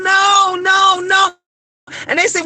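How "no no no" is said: retold crying no. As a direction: relive the child sobbing out no. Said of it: "and I'm crying, I'm like".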